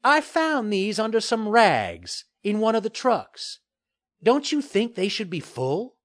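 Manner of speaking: high, innocent-sounding character voice; needling woman reporter. A narrator's reading. Reporter questioning the developer about empty cans, innocent needling. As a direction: pose it lightly, high and pointed. Maxine's figure voice.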